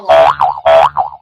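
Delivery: very low, slow, loud monster voice; slowed-down deep voice effect. Pretend. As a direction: drag it out deep like a slowed-down demon. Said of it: "We don't want that one".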